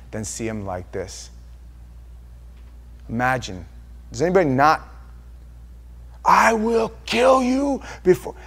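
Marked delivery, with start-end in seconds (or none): 6.2-8.4 high, slow shouted echo of the threat; a shorter replay of the father's shout. Retold or imitated. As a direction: call back the father's yell. Quoting father's threat again.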